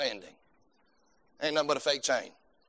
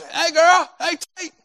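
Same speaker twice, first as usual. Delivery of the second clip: much higher, loud Vic greeting; imitating Vic; 12 semitones higher, 6 dB louder, slower than usual. Vic greeting people in the hallway, much higher. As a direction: pipe it up high like Vic. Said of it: "that's how he always was".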